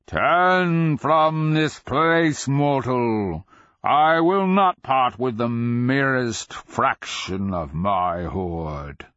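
very slow, high, loud dragon command; guard your hoard with a booming warning. A narrator's reading. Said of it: "dragon's booming echo".

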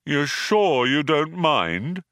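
plaintive robot doubt, drawn out; probing. A narrator's reading. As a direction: probe needily for reassurance. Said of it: "probed Marvin".

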